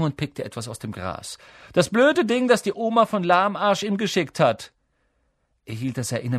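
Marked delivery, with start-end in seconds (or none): 1.7-4.7 high, loud scorn; scornful schoolboy jeer; mocking sneer. A narrator's reading. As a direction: ridicule it in a raised voice. Bully mocks a gift.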